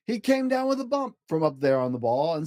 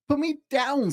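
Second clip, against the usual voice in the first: much higher, complaining fish; squeaky fish protest; 11 semitones higher than usual. Acted. Fish held up by the cat, high and complaining. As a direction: whine to be let down.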